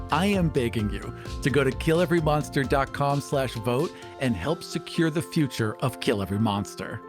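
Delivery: ordinary talk, nothing marked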